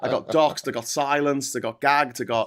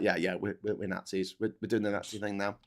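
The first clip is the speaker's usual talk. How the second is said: quiet, offhand shrug of an admission; mock shrug; 5 semitones lower, 9 dB quieter than usual. Mocking the agency's casual admission, quieter. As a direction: toss it off like it's nothing. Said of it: "they basically are just like".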